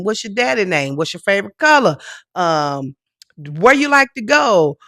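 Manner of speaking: usual speaking voice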